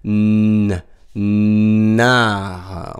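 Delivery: "renard" very slow, with a long held n; stretched n sound. Shown as a demonstration. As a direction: split "re" from a humming "nnnard". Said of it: "On entend ne aussi".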